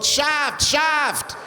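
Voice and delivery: calling out. Retold or imitated. Strangers calling out in street.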